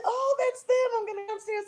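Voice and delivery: put-on high girlish voice, eager to run down; a little girl bursting to go downstairs; excited young girl. Young self voiced in story.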